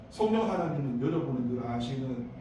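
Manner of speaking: normal talking voice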